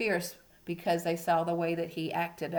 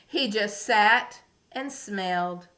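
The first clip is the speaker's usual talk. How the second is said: slow, higher storybook voice; reading to a toddler; 5 semitones higher, 8 dB louder, slower than usual. A narrator's reading. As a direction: read it slowly for little ones. Reading story to young children.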